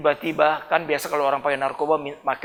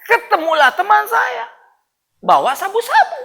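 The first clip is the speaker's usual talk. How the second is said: laughing; 4 dB louder than usual. Real, not acted. Laughs recalling the drugs.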